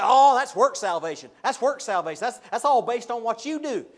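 loud, scoffing church member; mock objector. Preacher imitating people who call obedience works salvation, loud and scoffing. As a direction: bark the objection back at the preacher. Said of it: "they'll say".

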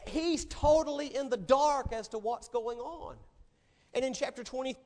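normal talking voice

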